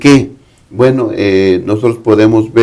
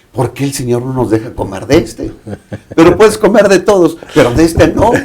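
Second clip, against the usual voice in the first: complaining; 4 semitones higher than usual. Retold or imitated. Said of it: "pudiera quejarse Adán y Eva".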